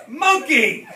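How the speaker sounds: high loud yell with a laugh. A narrator's reading. Scipio yelling about his monkey, loud laughing yell. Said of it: "yelled Scipio".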